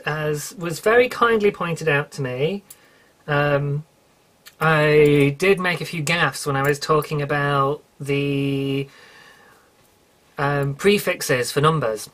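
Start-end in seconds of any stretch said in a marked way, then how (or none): none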